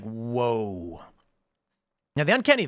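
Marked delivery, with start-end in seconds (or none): 0.0-1.0 low, hushed whoa; stunned whoa. Retold or imitated. Passerby realizing the stranger is a robot, low stunned whoa. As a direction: let it drop in awe. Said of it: "you're gonna be like".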